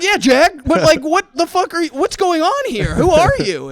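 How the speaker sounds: high, loud, baffled demand; exasperated disbelief. Retold or imitated. Pressing Jack about who he is, high baffled shout. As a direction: pile on the confusion, almost shouting.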